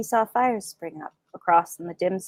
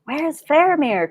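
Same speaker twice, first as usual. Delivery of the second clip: raised, dismayed cry; 8 semitones higher, 5 dB louder than usual. Acted. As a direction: call out in dismay. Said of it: "cried Beregond in dismay".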